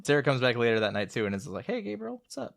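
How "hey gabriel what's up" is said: high, soft little greeting; voiced greeting to cat. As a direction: imitate someone softly cooing to a cat. Voicing Sarah greeting the cat.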